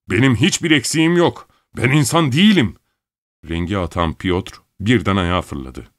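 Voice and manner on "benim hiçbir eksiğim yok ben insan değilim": high, raised sarcastic protest; bitter angry shout. A narrator's reading. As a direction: cry out the grievance at high pitch. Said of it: "bunu öylesine yüksek sesle söylemişti".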